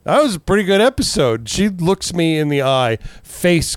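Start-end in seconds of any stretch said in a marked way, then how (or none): 0.0-1.5 casual. Retold or imitated. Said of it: "I look over at her and I was like".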